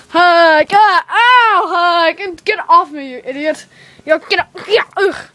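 high, loud scuffle cries; struggling shouts. Acted. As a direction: yell while fighting over a hug. Acted struggle over a forced hug.

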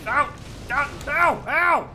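loud pained yelps; raging gamer. Real, not acted. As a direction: yelp in pain. Getting hit repeatedly in game.

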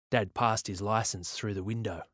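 ordinary delivery, nothing marked

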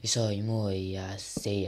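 very slow, hushed drawl; slowed-down talk. Shown as a demonstration. Gamer showing viewers he runs out of topics, slowed-down talk. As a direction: drag the words out almost in a whisper. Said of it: "no puedo hablar tan rápido".

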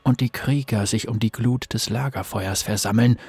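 low, hushed, ominous storyteller; horror trailer voice. A narrator's reading. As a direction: tell it like a dark campfire legend. Dark legend told at fireside. (tiefe, gedämpfte Horror-Erzählstimme)

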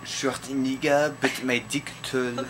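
slow, thick French accent. Shown as a demonstration. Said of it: "Say in the French accent".

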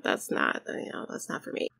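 breathy, soft throwaway whisper; whispered aside. Acted. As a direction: drop it to a whisper. Aside in character's refusal.

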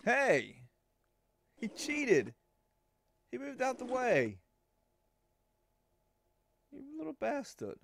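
high, loud outraged shout; indignant yelling. Real, not acted. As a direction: protest like you were robbed. Outraged at cheating pin.

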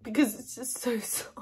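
breathy voiceless whisper with a giggle inside; whispered laugh. Real, not acted. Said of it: "I'm literally crying".

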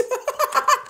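shrill burst of laughing; high squeal of laughter. Real, not acted.

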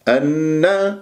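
usual speaking voice

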